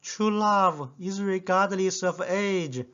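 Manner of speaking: stubborn schoolboy insisting. Retold or imitated. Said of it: "I was very stubborn and said".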